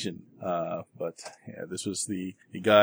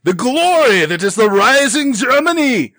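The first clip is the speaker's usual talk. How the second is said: grand villain proclamation; 10 dB louder than usual. Acted. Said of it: "It's like".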